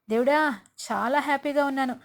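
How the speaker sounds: usual speaking voice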